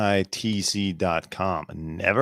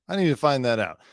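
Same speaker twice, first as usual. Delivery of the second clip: normal talking voice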